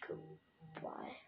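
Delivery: soft unvoiced goodbye; whispered goodbye. Real, not acted. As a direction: breathe out a goodbye. Falling off in game.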